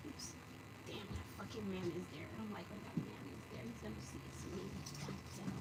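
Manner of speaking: soft hissed curses to herself; hushed whisper. Real, not acted. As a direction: whisper so the man won't hear. Hiding from neighbour on walk.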